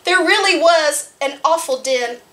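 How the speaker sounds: put-on lively read-aloud voice for small children; storytime narration. Librarian reading a farm picture book to children, lively.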